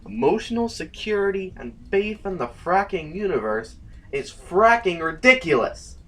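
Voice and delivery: loud, sarcastic rising fury; bitter outburst. Acted. Saul snapping back at Moss's brutal honesty, loud bitter sarcasm. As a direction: explode with sarcasm, slowing at the end.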